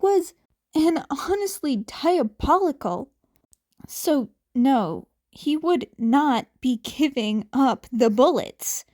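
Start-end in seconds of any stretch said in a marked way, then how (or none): none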